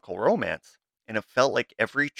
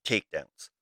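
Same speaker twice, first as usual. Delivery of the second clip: ordinary delivery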